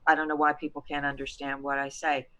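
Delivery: soft, subdued imitation of a client; quietly voiced, muted complaint; stuck adult voice. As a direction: act out someone whose voice stays held in. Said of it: "you're going".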